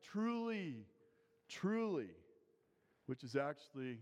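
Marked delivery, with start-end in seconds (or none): 0.0-2.1 "Truly, truly" each word punched high with a hard fall; "Truly, truly" given higher, insistent emphasis; emphatic. Retold or imitated. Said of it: "with an emphatic".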